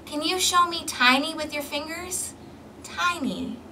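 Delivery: ordinary delivery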